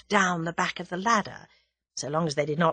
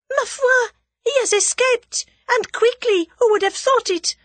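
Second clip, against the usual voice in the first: much higher, louder ringing call; clear and high; 5 dB louder than usual. A narrator's reading. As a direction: call out so Tommy hears. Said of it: "clear and high".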